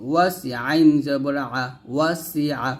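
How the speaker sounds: ordinary talk, nothing marked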